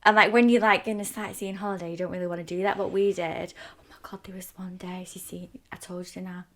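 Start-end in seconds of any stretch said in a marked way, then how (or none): 3.8-6.4 whispered aside. Real, not acted. Leaning in to tell Cece, whispered. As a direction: hush it like sharing a secret. Aside to friend, telling secret.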